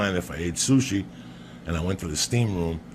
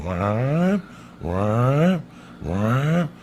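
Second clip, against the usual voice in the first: buzzer imitation; 4 semitones higher, much slower than usual. Storyteller mimicking the pool alarm buzzer, mock wah. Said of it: "it's going".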